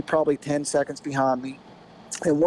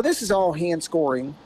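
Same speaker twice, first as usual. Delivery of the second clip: usual speaking voice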